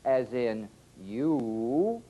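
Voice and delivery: you with a long rounded oo; slow oo in you. Shown as a demonstration. Teacher slowly modelling the oo vowel.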